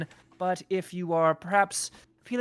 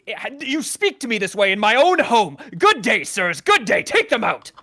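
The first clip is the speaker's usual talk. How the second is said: hammed-up indignant shout, louder and higher; mock outrage; 4 semitones higher, 7 dB louder than usual. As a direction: play the offended host throwing them out. Innkeeper feigns outrage, argument.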